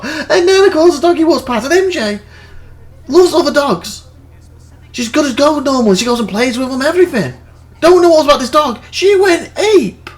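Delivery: high-pitched, incredulous storytelling; amused exasperation. Real, not acted. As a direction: tell it in a squeaky, worked-up voice. Cracking up over the dog story.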